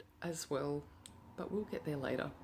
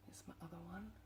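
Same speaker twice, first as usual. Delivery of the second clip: much softer breathy whisper; 15 dB quieter, much faster than usual. Real, not acted. Whispering to viewers as she finds the other key. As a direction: whisper it like a secret. Hushed find.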